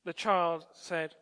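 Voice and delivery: normal talking voice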